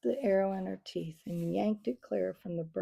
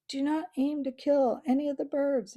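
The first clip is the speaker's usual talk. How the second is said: raised, higher call; abbot cries out; 6 semitones higher, 6 dB louder than usual. Acted. Abbot calls to the archers not to kill, raised voice. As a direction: cry out a firm order. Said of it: "the abbot cried".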